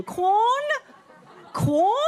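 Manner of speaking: Quorn? with a high rising question lilt; apologetic question. Shown as a demonstration. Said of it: "It sounds like a question".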